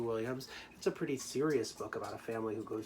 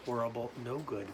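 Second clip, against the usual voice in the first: usual speaking voice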